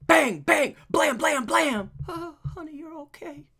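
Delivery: high, loud cartoon shootout voice; high comic voice. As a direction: act out the gunfight panels high and loud. Voicing comic panels.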